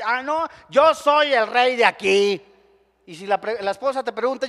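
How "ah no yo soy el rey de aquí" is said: louder, slow boastful mock husband; arrogant husband. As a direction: proclaim yourself king of the house. Arrogant husband arriving home.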